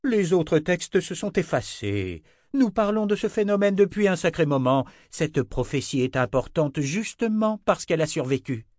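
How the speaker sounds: exasperated Anna. A narrator's reading. Anna lectures Richard, high and exasperated. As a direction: lecture him like a cross teacher. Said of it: "s'écria-t-elle".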